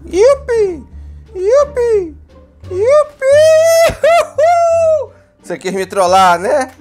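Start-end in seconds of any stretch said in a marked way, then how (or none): 0.0-5.1 high gleeful whoops; zip line. Real, not acted. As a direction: cheer like flying down a zip line. Riding zip line, cheering.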